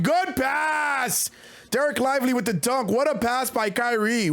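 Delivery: sudden high yell of delight; excited shout. Real, not acted. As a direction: blow out the mic on a great pass. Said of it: "Sorry for your eardrums there".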